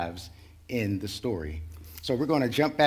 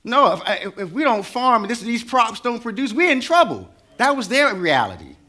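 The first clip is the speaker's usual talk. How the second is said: much higher, loud impassioned preaching; preacher shouting the point home; preaching at full force; 10 semitones higher, 7 dB louder than usual. Acted. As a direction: cry it out to the congregation. Preaching climax on survival.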